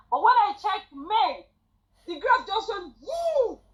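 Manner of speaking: high, rising thrill; excited. Real, not acted. Describing the earnings graph shooting up, excited. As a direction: ride the graph up with your voice. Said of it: "graph soaring".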